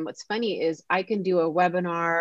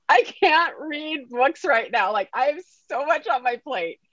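overwhelmed; 9 semitones higher, slower than usual. Retold or imitated. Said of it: "I almost started crying".

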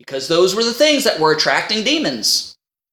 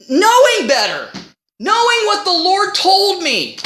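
normal talking voice

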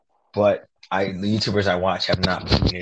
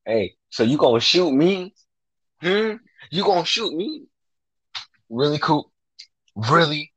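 mock challenge; 7 semitones higher than usual. Acting out mocking challenge to Coop.

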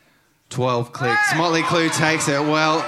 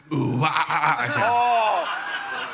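kookaburra laugh; much slower than usual. Shown as a demonstration. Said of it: "Sounds like a kookaburra, I reckon".

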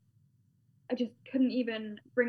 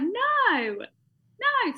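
high squeaky denial; 11 semitones higher, 6 dB louder than usual. Retold or imitated. Daughter denying to mum, high flustered no. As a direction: pitch jumps way up on no, no.

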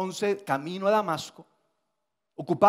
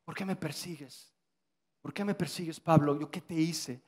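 muy bajito, casi susurrado y dolido; voz suave de Jesús; 2 semitones lower, 7 dB quieter than usual. Acted. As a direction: pregunta con ternura herida. (Jesús pregunta a Pablo, muy suave y triste)

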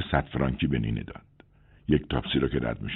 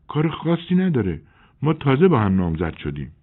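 a woman's voice pitched higher, a bit dazed; lightly played drowsy neighbour; addict neighbour woman; 8 semitones higher than usual. A narrator's reading. Celine answers Maigret. (زن همسایه، کمی گیج، صدای بالاتر)

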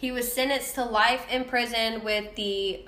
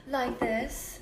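sexy voice; 6 dB quieter than usual. Pretend. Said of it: "Can you use your sexy voice".

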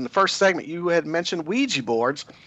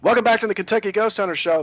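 upbeat radio host welcome; radio host intro; 8 semitones higher than usual. Acted. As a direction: open the show like a talk radio host. Radio host opening the show.

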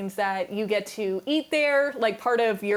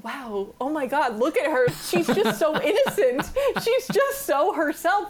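smitten great-grandfather; 5 semitones higher than usual. Retold or imitated. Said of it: "He was like".

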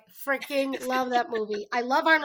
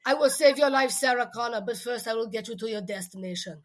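loud mock Arnold Schwarzenegger accent; playful Arnold Schwarzenegger accent as a navigation voice; Arnold impression; 4 dB louder than usual. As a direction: boom it out like a movie robot in an Austrian accent. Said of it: "He's like".

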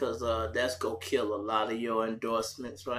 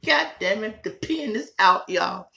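frustrated exclamation; 9 semitones higher, 8 dB louder than usual. Real, not acted. Vape pen empty.

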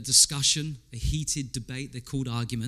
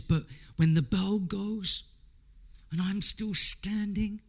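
slow, lightly voiced Rocky; 5 semitones higher, 4 dB louder, slower than usual. Preacher quoting Rocky's vow to Adrian, slow and light. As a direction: draw it out like a tired fighter. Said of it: "he sounds like he's half drunk".

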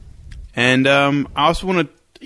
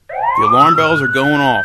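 alarm bells voice; 6 dB louder than usual. Acted. Mock alarm over the panic.

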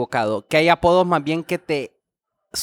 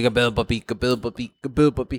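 much lower put-on greeting; mock Papi greeting; 9 semitones lower, 3 dB quieter, faster than usual. Imitating Papi greeting everyone, much lower put-on voice. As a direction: drop the voice low and swagger it.

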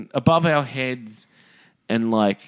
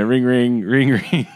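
phone ringing; 4 dB louder, slower than usual. Retold or imitated. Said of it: "you're like".

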